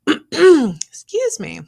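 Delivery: strained, hoarse apology. Real, not acted. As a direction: voice giving out, clearing throat. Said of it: "losing my voice".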